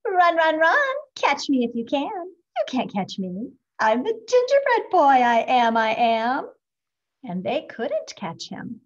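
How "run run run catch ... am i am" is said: higher, louder cheeky taunt; taunting call. A narrator's reading. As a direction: tease them as you run off. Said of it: "laughed and called out".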